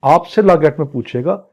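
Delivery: ordinary delivery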